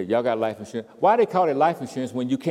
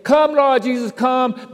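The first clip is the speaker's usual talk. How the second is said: loud, high, demanding "Come, Lord Jesus"; selfish prayer; 10 dB louder, slower than usual. Shown as a demonstration. Preacher mocking the wrong way to pray, loud and high. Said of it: "what I would be saying is".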